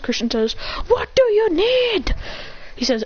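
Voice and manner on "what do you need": high, drawn-out demand; exasperated question. Acted.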